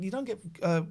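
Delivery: ordinary talk, nothing marked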